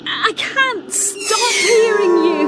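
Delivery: high, strained cry breaking into a shout; anguished cry. Acted. Bria, alone on the shore, crying out at the voices in anguish. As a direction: cry out in panic at voices no one else hears.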